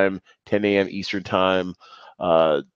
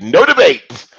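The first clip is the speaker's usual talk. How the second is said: emphatic declaration; 5 semitones higher, 8 dB louder than usual. Real, not acted. Insists on favorite movie.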